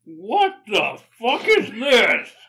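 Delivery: loud, slow, shocked disgust. Real, not acted. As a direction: recoil in loud horror at the taste. Disgusted first taste of candy.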